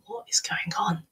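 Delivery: near-whispered inner question; hushed bewilderment. Retold or imitated. Teller replays her thought as boss keeps pressing her, hushed bewilderment. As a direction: say it under your breath, baffled.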